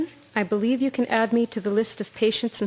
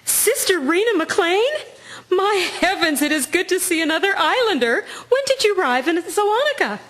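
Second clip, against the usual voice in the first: delighted reunion; 7 semitones higher than usual. Acted. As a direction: greet an old friend from home with delight. Joyful reunion of two islanders.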